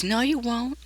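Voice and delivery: airy high-pitched warning; high eerie figure. Acted. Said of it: "She says".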